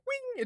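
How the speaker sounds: very high ringing sound effect; ping it out like a light blade; imitated ringing sound. Shown as a demonstration. Game master mocks a slick flip, high ping. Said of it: "you can't just be like".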